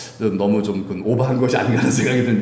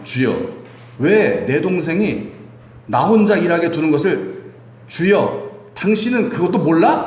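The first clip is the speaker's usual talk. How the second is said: higher, louder, slow scolding of the Lord; irritated complaint; 6 semitones higher, 3 dB louder than usual. Acted. Martha grumbling at Jesus while she works, irritated. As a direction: complain like an annoyed sister scolding. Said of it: "예수님을 꾸짖고 있는 거예요".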